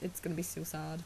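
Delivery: normal talking voice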